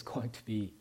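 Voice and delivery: hushed excited whisper. Acted. Preacher telling the congregation a joyful secret, whispered. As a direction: breathe it like a secret.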